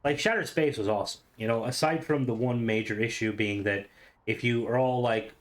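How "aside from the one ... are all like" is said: usual speaking voice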